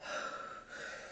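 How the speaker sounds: long, soft breath in and out; deep calming breath. Shown as a demonstration. Woman showing how breathing stops crying, deep breath. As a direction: demonstrate a slow deep breath. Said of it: "take deep breaths".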